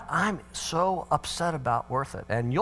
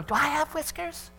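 put-on high-pitched child's question; little boy's voice; 12 semitones higher, 4 dB quieter than usual. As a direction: play a small son quizzing his father. Little son questions his dad.

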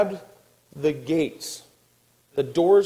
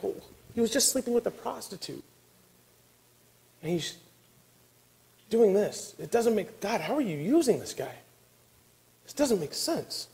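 high, softened disbelief, pitch swooping up; hushed, incredulous complaint; bewildered protest; 6 semitones higher, 6 dB quieter than usual. Acted. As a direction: question God in baffled, higher-pitched tones. Acted bewildered questioning of God.

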